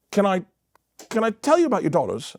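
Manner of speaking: imitated British comic, pitched high; British accent. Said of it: "really smart British comic".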